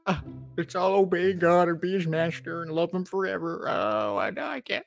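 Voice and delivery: high, slow, hammed-up fake distress; mock the preacher with fake distress; mock despair. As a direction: pretend to panic, high and whiny. Mocking fear of preacher.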